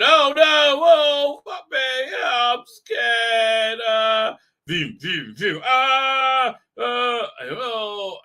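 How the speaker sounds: high, loud hammed-up panic; mock terror. Anakin reliving the fight, yelping in hammed-up fear. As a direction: yelp and flail through a nightmare. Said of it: "he remembering the fight".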